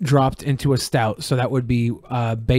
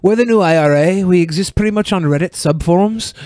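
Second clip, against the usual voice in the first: higher, louder mock announcement; mocking spokesman; 6 semitones higher, 4 dB louder than usual. Co-host mimics the new IRA spokesman, mocking. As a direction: make fun of them as you speak for them.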